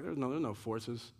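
lightly voiced smug churchgoer; mock complacent churchgoer. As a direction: brush it off like a comfortable churchgoer. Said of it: "Americans, even in the church, are like".

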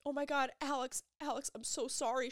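high, distressed imitation of her apology; retold sobbing. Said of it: "She's sobbing, she's sobbing".

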